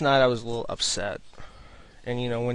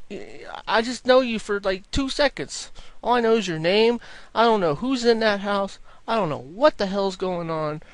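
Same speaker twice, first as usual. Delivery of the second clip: incredulous reply; 8 semitones higher, 4 dB louder than usual. Retold or imitated. Retold own incredulous reply.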